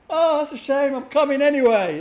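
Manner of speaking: breezily ignore the refusal; cheeky Crow. Retold or imitated. Streamer mimicking Crow shrugging off the refusal, loud. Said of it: "And he's like".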